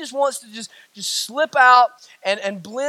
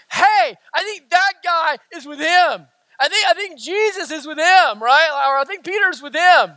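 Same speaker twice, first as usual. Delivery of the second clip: higher-pitched accusation to a crowd; emphatic calling out; 4 semitones higher than usual. Acted. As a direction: call him out for everyone to hear. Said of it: "her calling out of Peter is more emphatic".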